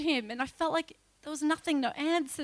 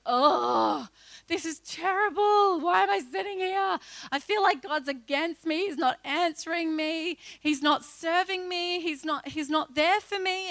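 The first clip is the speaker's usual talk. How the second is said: higher, louder grumbling lament; mock despairing complaint; 4 semitones higher, 4 dB louder than usual. Bored at the conference, moaning to herself, higher and louder. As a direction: moan about being forgotten. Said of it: "I sat there going".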